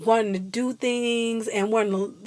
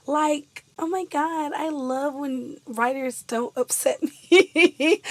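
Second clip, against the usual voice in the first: laughing; 5 semitones higher, slower than usual. Real, not acted. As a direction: laugh through it, slowed down. Laughing mid line.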